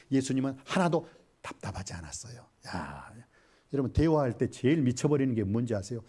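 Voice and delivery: usual speaking voice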